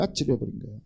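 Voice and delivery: hushed dizzy. Shown as a demonstration.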